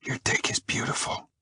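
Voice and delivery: hushed, intimate whisper; barely voiced, sultry whisper; dirty whisper. A narrator's reading. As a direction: whisper it like a naughty secret. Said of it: "Her voice is a dirty whisper".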